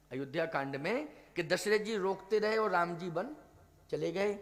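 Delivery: usual speaking voice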